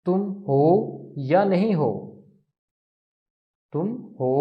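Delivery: ordinary talk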